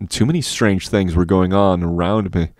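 plain reading voice

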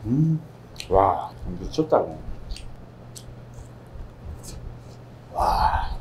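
chewing while talking; words muffled by food; mouth full. Real, not acted. Seen 3: Cheeks bulge, lips pursed chewing pork while talking throughout.

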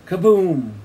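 booming falling kaboom; storyteller's sudden boom; bomb explosion. A narrator's reading. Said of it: "And another".

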